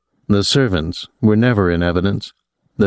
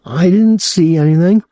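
high, louder whine of protest; higher, louder whiny denial; whining; 9 semitones higher, 4 dB louder than usual. A narrator's reading. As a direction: whine it like a caught kid. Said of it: "Claude whined".

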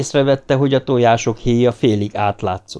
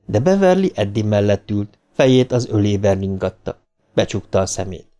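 plain reading voice

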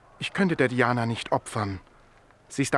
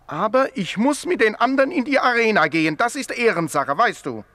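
louder and higher, firmly declared; raised voice insisting on a point of honour; emphatic insistence; 9 semitones higher, 8 dB louder than usual. Acted. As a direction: stand on your honour, out loud. Insists on entering the arena.

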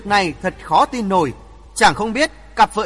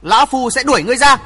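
higher, louder, fast angry threat; glaring threat; 5 semitones higher, 6 dB louder, faster than usual. A narrator's reading. Glaring old hermit threatening to throw him out, angry. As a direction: spit the threat out quickly. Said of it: "trợn mắt nói".

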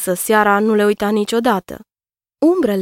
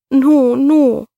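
higher and louder, a wailing protest; sobbing denial; 5 semitones higher, 5 dB louder than usual. A narrator's reading. Zahra denying the accusation in front of her husband, sobbing. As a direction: cry it out through hiccuping sobs. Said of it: "plângea Zahra cu sughițuri".

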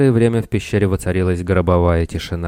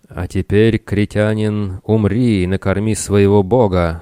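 ordinary delivery, nothing marked

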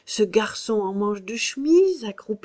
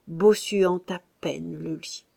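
ordinary delivery

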